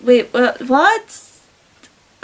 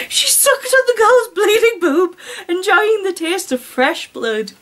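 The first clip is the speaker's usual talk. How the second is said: higher, giggling reread; laughing through it; 8 semitones higher than usual. Shown as a demonstration. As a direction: read it again, barely holding the laugh in.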